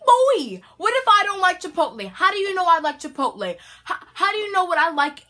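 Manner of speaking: sassy disbelief; indignant. Retold or imitated. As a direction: call him out, offended. Outraged rant at stranger.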